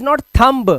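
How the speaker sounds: thumb as "tham-buh", b sounded; wrong pronunciation. Shown as a demonstration. Teacher shows class the mistake, thumb with spoken b, mispronounced. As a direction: release a "buh" at the end of thumb. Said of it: "thumb नहीं".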